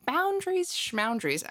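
high-pitched mock scoff on the first word; sing-song dismissive imitation, starting high; mocking dismissal. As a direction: mimic someone brushing off boundaries. Mimics relatives' dismissive reply.

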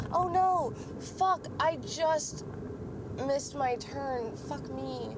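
high, sudden dismay at a missed turn; shocked dismay. Real, not acted. Realizing she missed her turn while driving, dismayed.

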